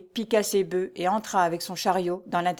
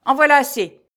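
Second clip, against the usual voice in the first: high, loud, sharp cut-off; cutting steward; 7 semitones higher, 10 dB louder than usual. A narrator's reading. Steward cutting off the peasant's protest, loud and curt. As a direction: slam the door on his plea. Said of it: "reprit le majordome en lui coupant la parole".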